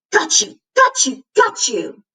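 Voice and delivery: a lightly voiced triumphant cat cry, spiking high; triumphant roar. A narrator's reading. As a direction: gloat as you pounce. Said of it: "he roared triumphantly".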